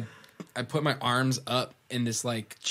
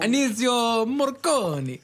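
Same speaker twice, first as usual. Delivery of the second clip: Italian accent; 11 semitones higher, 6 dB louder, slower than usual. Shown as a demonstration. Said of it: "I have to say it, like, in the Italian accent".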